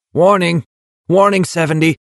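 barked high military warning; loud call. A narrator's reading. Soldier calls warning.

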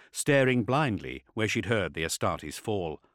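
ordinary delivery, nothing marked

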